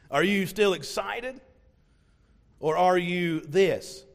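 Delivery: usual speaking voice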